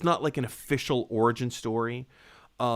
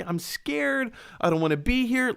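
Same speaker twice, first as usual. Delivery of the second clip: whiny scared Flash; 10 semitones higher than usual. Retold or imitated. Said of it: "he was all like".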